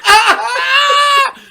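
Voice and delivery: high, loud comic sob; loud exaggerated cry; mock bawling wail. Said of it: "I'm literally bawling".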